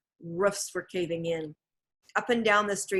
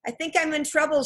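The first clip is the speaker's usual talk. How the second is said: worried call; 8 semitones higher, faster than usual. A narrator's reading. Trapped girl calls for help.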